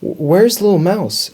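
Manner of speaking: high, slow, anxious question; worried. A narrator's reading. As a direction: ask like an anxious mother. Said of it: "Mummy Mouse was getting worried".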